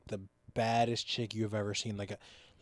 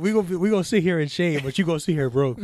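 excited; 5 semitones higher, 7 dB louder than usual. Real, not acted. Excited joking rant.

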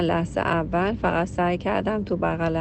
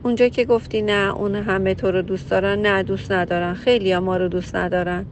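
usual speaking voice

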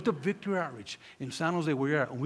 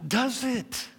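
triumphant preaching; 4 semitones higher, 7 dB louder, much slower than usual. Acted.

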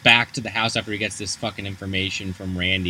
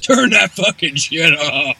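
drawn-out, higher, louder holler; mock angry yell; 6 semitones higher, 5 dB louder, slower than usual. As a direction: yell a hammed-up noise complaint. Acts cops' noise complaint.

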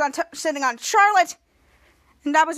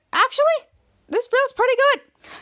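pleased squeak; 10 semitones higher, 4 dB louder than usual. Retold or imitated. Ray enjoys being sat on.

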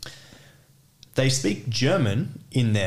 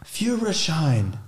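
slow, higher-pitched sounding-out of a foreign word; clueless customer reading; 5 semitones higher, much slower than usual. Acted. Customer reading the German word to an official, slow and halting. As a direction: read it out laboriously off the paper. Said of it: "look at a paper and be like".

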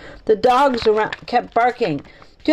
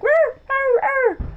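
very high yappy dog barks; high-pitched mock barks; dog barking. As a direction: imitate excited dogs barking. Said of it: "The dogs barked and barked".

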